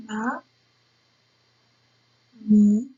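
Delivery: "ma, mi" as separate syllables with long pauses; syllable-by-syllable dictation of "ma, mi"; spelling out while writing. Shown as a demonstration. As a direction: say "ma, mi" slowly, at the pace of handwriting. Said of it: "Alors j'écris".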